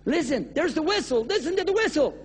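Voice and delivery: high urgent plea. Acted. Preacher voicing the man warning of the train, urgent. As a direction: urge him to hear the whistle. Pleading urgently about whistle.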